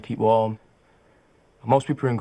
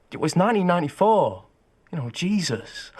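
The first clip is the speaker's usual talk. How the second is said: higher, indignant protest; exasperated; 7 semitones higher than usual. Acted. Venting about last night's vote, exasperated. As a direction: burst out in exasperated disbelief.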